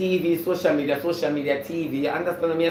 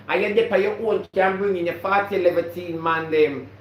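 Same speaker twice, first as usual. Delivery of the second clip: normal talking voice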